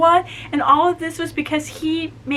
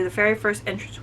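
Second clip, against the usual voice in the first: usual speaking voice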